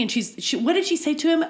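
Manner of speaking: normal talking voice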